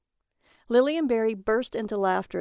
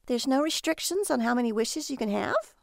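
higher, incredulous female voice; questioning wife; 4 semitones higher than usual. Acted. Lily quizzing Barry about the wishes, higher and incredulous. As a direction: ask in wary wonder.

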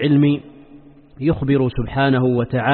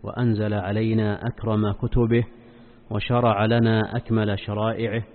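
solemn, measured sermon opening; formal standard arabic; 4 semitones lower, 6 dB quieter than usual. Acted. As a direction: recite the opening praise formally. Formal opening praise formula. (افتتاحية خطبة رسمية هادئة)

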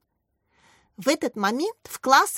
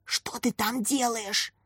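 hushed question; 5 dB quieter, slower than usual. A narrator's reading. Asking the hiding spy.

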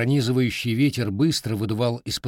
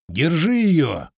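slow, loud, higher call to catch her; shout; 6 semitones higher, 6 dB louder, much slower than usual. A narrator's reading. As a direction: yell it down the alley. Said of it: "послышался возглас". (громкий окрик, протяжно)

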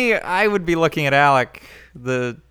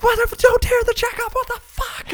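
falsetto. Retold or imitated. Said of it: "the blonde girl is like".

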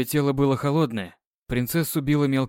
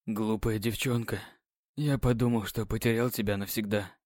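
tender relief; 4 semitones lower, 7 dB quieter than usual. Acted.

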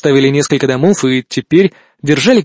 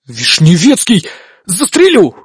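angry bark; 4 semitones higher, slower than usual. Acted. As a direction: snap the threat at him. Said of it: "рявкнул я". (яростный рявк)

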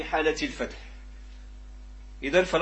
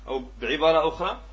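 normal talking voice

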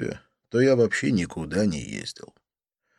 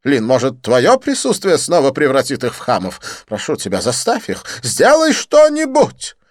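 high, loud, theatrical French friend; excited Didier; 12 semitones higher, 10 dB louder than usual. Acted. Didier greeting his friend Lin, high excited gush.

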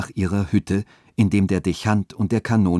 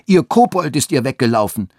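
raised, higher-pitched jeer; called-out taunt; 5 semitones higher, 4 dB louder than usual. A narrator's reading. Boys running after old Gertrud and her goat, jeering shout. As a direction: call it after her, mocking. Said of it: "Andere riefen".

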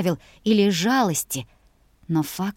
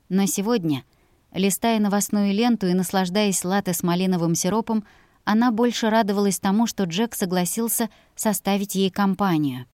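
ordinary delivery

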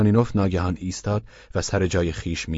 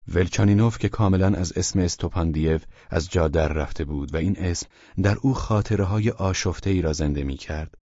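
ordinary delivery

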